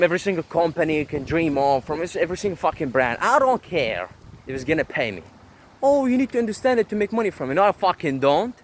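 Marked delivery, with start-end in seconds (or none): 5.8-7.5 mocking whine. Mocking critics' advice.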